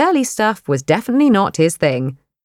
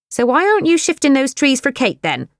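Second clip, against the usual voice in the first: higher, sharp challenge; spirited older woman; 8 semitones higher than usual. Acted. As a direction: poke at his pride. Pat challenges Wayne.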